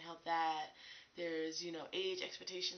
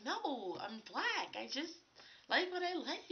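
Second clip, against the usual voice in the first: exasperated retort; 8 semitones higher, slower than usual. Retold or imitated. Retorting to a nosy questioner.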